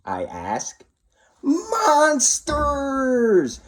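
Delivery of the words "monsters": put-on high, small child voice hissing; child's hiss. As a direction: hiss it like a scared little kid. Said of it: "you hiss".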